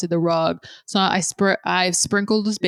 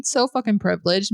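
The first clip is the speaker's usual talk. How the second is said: ordinary talk, nothing marked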